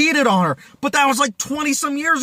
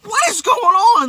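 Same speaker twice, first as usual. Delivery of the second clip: exasperated; 11 semitones higher than usual. Real, not acted. Ranting about frustrating film.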